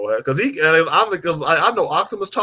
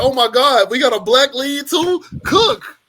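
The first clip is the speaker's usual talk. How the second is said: high, loud excited reaction; 8 semitones higher, 4 dB louder, slower than usual. Retold or imitated. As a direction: hype it up, louder and higher. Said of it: "I was like".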